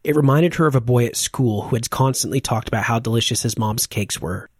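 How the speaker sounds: plain reading voice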